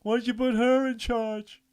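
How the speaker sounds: high, loud indignant question; mock outrage. Co-host joking about the girl's survival, mock outrage.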